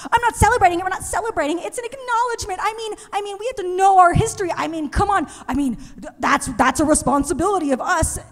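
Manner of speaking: high, fast flustered excuses; panicked backpedal. Retold or imitated. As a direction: scramble to backpedal. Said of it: "She panics".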